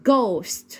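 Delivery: "ghost" opening on a hard g; teacher's model "ghost", Egyptian gim first. Shown as a demonstration. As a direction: say "ghost" like "gost". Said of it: "بنطقها as in ج".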